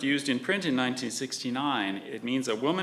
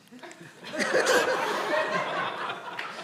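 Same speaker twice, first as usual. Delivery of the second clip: laughing. Real, not acted. Amused at cat Reiki.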